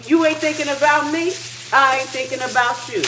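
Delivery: high, loud, dismissive; telling off an ex; brushing off bills like an ex; sassy breakup talk. Retold or imitated. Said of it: "One day I said".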